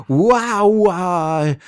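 high, loud pained wow; burning yelp. Acted. Chief yelping at the whiskey burn, high and loud. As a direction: yelp as the liquor burns.

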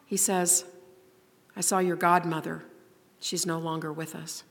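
hushed solemn poem reading. Acted. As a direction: read the grim replies quietly and heavily. Reads the adult's grim replies.

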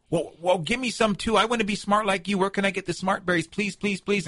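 high, pleading Badger voice; trickster tale voices. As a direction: beg like a desperate little animal. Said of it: "Badger starts to beg".

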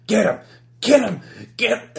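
loud, high shouted order; cold voice yelling at his men to grab him; shouting. Acted. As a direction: bark the order to attack.

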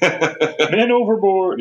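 high, very slow hammed-up call of an old sea captain; mock admiral call. Imitating the admiral calling out to the crew, high and drawn out. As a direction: drag it out like a pompous admiral.

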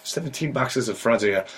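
ordinary delivery

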